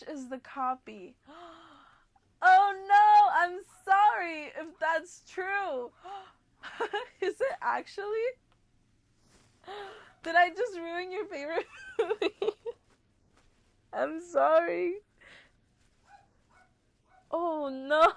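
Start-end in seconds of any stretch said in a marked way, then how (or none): 2.4-5.9 loud, high, crying oh no then slow sorry; real distress over ruining a favorite; dismayed apology. Real, not acted. Reacting to shocking news. 10.2-18.1 loud, high, laughing and crying apology; half-laugh, half-wail it; real laughing dismay; guilty apology. Real, not acted. Reacting to shocking news.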